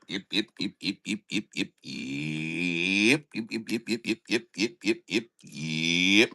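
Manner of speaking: low, soft repeated yeps; Muppet alien yip. Retold or imitated. Host correcting himself with the Muppet yep call, low and soft. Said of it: "Or is that the".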